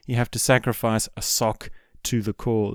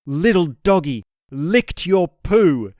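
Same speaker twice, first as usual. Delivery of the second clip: higher, emphatic mock outrage; appalled revelation; 6 semitones higher, 3 dB louder than usual. Girlfriend revealing how little doggy found it, mock appalled. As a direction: punch out the damning news in a raised voice.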